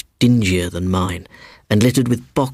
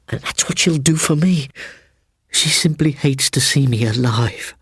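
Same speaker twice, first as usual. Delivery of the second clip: put-on higher, urgent boyish voice; frightened boy; 8 semitones higher than usual. A narrator's reading. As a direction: pour out the fear in a rush. Frightened boy accusing aunt.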